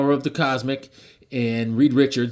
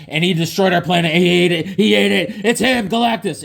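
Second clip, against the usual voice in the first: rising louder and higher to a shout; building alarm, each line hotter; excited Jor-El; 5 semitones higher, 4 dB louder than usual. Retold or imitated. Jor-El warning Superman about Galactus, building to a shout. Jor-El reveals the planet-eater.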